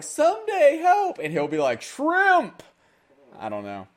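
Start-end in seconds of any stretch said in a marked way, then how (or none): 0.0-1.2 very high squeaky cry; mock plea for help. Team begging its player to rescue them, high mock plea. 1.8-2.6 very high, loud squawk; mock cry. Player answering his team's plea, high silly shout. Acted reply, second version of skit.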